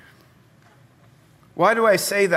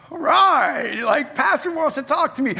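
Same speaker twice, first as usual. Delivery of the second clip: much higher, louder, eager voice; excited; 10 semitones higher, 4 dB louder than usual. Retold or imitated. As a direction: replay your naive excitement at being summoned. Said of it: "I actually was excited".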